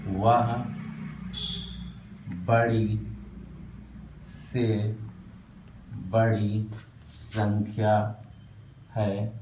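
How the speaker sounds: slow, word by word, long pauses; slow dictation. Shown as a demonstration. Teacher dictating the definition slowly to students. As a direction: dictate each word for writing. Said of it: "write down करो".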